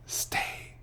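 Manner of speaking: breathy spooky sign-off whisper. Acted. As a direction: hush it like a secret goodbye.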